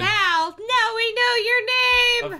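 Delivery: high, loud, drawn-out crow; singsong exclamation over a blunder; mock gotcha. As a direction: crow over the slip playfully. Listener gleefully jumps on the name.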